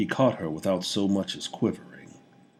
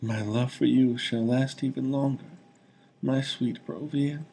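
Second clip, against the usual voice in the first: last words slipping away; dying whisper; 7 semitones higher than usual. A narrator's reading. Dying woman speaks her last to the king. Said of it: "she whispered".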